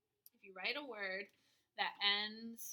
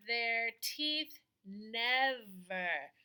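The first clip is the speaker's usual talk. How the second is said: slow reread; 6 dB louder, much slower than usual. Shown as a demonstration. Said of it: "slow rereading".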